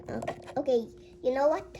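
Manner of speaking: ordinary talk, nothing marked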